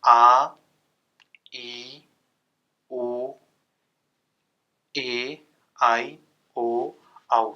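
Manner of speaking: each vowel held about twice as long; long vowels drawn out. Shown as a demonstration. Teacher demonstrating long vowels to class, held long. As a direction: stretch every vowel sound out slowly. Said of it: "जैसे".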